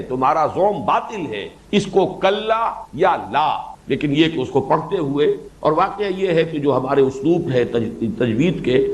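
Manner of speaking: normal talking voice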